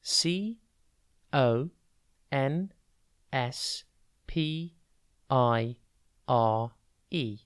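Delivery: slow, letter by letter with pauses; each letter separate and evenly spaced; spelling out. Shown as a demonstration. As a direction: spell it out one letter at a time. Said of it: "and it is spelt".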